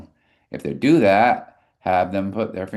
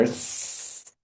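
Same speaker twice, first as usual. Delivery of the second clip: long unvoiced snake hiss; 9 dB quieter than usual. Shown as a demonstration. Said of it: "you can be a snake".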